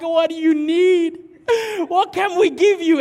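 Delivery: high, loud, overwhelmed offer; mock tearful plea. Crying man offers help to the school ladies, hammed-up plea. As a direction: blurt it out as if helplessly moved.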